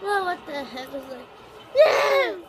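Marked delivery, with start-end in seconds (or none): 1.8-2.4 high, loud crying shout; half laughing, half wailing No; anguished shout. Acted. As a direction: yell in mock despair at the game. Outburst at game events.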